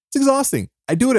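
high-pitched exasperated repeat of "It's exhausting"; exasperated squeal. Real, not acted.

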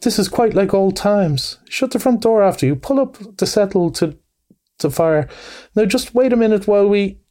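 high, eager bustling host; Mole, eager. A narrator's reading. Host settling guests by the fire, eager and high. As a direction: chatter out orders eagerly, pitched high. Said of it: "cried the Mole eagerly".

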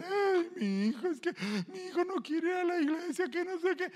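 mock wailing mother.

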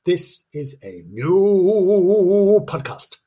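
very slow, drawn-out spooky announcer voice; mock spooky announcer. Shown as a demonstration. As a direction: stretch every word like a horror trailer. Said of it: "And this is a new podcast".

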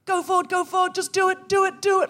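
high, pressing self-command on one note; mock war-film urging. Walker urging themselves like they're in Platoon, high and urgent. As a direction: drive yourself on like a soldier in battle. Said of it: "like I was in Platoon".

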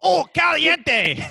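put-on high, slow, loud Spanish-accent exclamation; big cartoonish Mexican accent; mock Mexican accent. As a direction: shout it in a hammed-up Mexican accent. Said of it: "What would that sound like".

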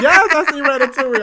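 high, loud imitation of gushing; hammed-up high-pitched enthusiasm; mock overexcitement. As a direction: squeal it in mock delight. Acts out his overexcited answer.

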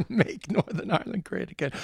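put-on laughing stage-Irish leprechaun accent. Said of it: "the racist leprechaun".

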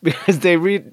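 usual speaking voice

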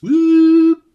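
high, loud whoop; excited whoop. Real, not acted. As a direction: let out a cheer. Sudden high cheer.

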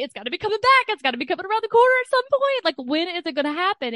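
anxious pitch rising into a nervous rush; real anxious worry. Real, not acted. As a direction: let the fear speed up and climb. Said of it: "I'm scared".

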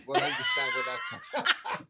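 bursting into laughter mid-line; laughing. Real, not acted. Astrologer laughing at his own cheeky joke.

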